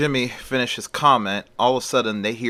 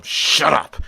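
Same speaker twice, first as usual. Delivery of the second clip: louder, higher acted shout; angry outburst; 5 semitones higher, 5 dB louder than usual. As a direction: burst out at mom, fed up. Son's outburst at his mother.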